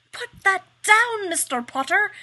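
high, loud shrill scolding; shrill shout. Acted. Headmistress scolding a student across the room, shrill shout. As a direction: shriek it like a stern headmistress. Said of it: "The headmistress shrills".

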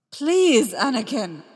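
Obi-Wan crying out as Anakin attacks, desperate shout. Acted. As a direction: shout a plea mid-fight. Obi-Wan blocking Anakin's strike.

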